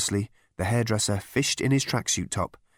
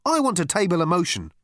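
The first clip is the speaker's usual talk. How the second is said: higher, strident committee voice; 8 semitones higher than usual. A narrator's reading. Girl addressing the committee, strident. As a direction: put it forward stridently. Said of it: "A girl with a strident voice".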